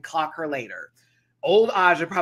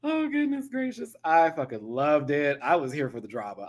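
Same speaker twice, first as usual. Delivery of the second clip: usual speaking voice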